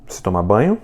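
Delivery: "Você toma banho?" with a rise on "banho"; rising question tune on "toma banho"; question intonation. Shown as a demonstration. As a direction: lift the end of "banho" to make it a question. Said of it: "É só mudar a entonação".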